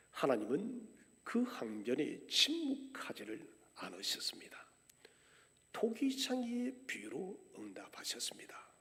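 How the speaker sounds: usual speaking voice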